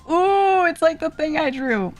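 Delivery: high, delighted "ooh" of recognition; excited recognition. Real, not acted. Reacting to a familiar drawing.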